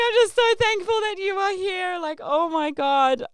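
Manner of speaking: high, loud wail of relief; overwhelmed relief. Retold or imitated. Traveller reliving her words to the returned stranger, overwhelmed relief.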